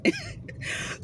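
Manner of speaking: breathless high-pitched laugh, almost crying; high squealing laugh. Real, not acted. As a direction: let a squeaky laugh burst out. Bursts out laughing at the story.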